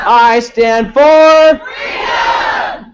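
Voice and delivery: slow, high, ringing shout; declaration shout. Shown as a demonstration. Leading declarations, a slow high freedom shout. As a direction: proclaim it to the rafters. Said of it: "the last statement is".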